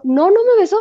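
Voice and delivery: high, loud insistent denial; Liliana denying. Retold or imitated. Liliana insisting to Brenda she was never kissed, high and loud. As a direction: snap back a firm no, pitched high.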